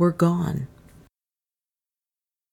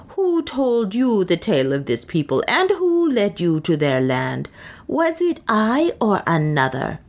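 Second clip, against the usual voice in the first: higher, indignant challenge; furious; 4 semitones higher than usual. A narrator's reading. Soa challenges Leonard over who guided him, furiously. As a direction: demand it with swooping, angry stresses. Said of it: "she answered furiously".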